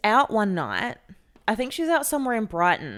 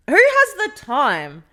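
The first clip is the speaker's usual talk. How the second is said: exasperated disbelief; 7 semitones higher, 10 dB louder, slower than usual. Real, not acted. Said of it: "exasperated rhetorical question".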